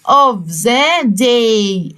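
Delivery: of the day stretched very slowly; slow model phrase. Shown as a demonstration. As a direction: draw out of the day for the class. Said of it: "في نهاية اليوم". (of the day ببطء شديد)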